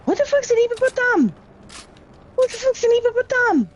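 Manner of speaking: hammed-up baffled shriek; mock disbelief. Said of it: "I'm like".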